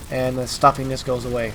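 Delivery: normal talking voice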